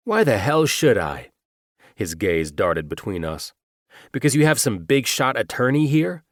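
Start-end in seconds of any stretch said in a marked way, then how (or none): 0.1-1.3 sharp, higher, louder retort; defiant man's voice. Acted. 4.1-6.2 higher, louder scoff; sneering man's voice. Acted. Antagonist sneering retort.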